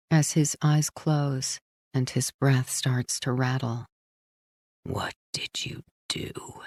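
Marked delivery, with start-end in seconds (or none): 4.8-6.6 airy, slow whisper; dying whisper. Acted. Dying Paul asks Frankie one last time, slow whisper. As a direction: breathe the question out with the last breath.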